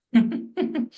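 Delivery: amused chuckle; short run of chuckles; laughing. Real, not acted. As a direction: laugh at your own comparison. Laughs after Modi comparison.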